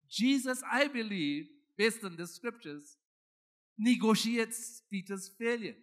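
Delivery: normal talking voice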